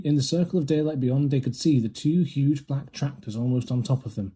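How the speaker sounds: plain reading voice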